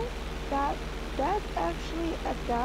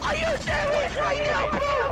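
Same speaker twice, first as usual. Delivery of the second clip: outraged yell; 13 semitones higher, slower than usual. Real, not acted. Teammate fails.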